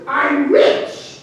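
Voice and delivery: high, triumphant brag; excited boast. Acted. Vaquero after telling his whole story, boasting high. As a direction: announce it high and proud.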